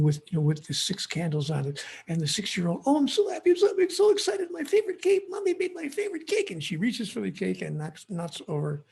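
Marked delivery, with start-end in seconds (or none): 2.9-6.5 put-on very high, squealing child's voice; excited little girl. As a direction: gush like a thrilled small girl at her party. Child voiced at birthday party.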